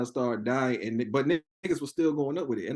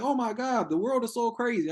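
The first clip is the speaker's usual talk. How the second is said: higher-pitched, lightly voiced worried relative; a touch of naive dismay, pitched up; mildly mock alarm; 7 semitones higher than usual. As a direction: imitate an older relative taken in by it. Older relative shocked by game video.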